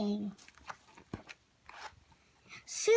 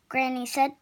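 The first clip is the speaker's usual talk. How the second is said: usual speaking voice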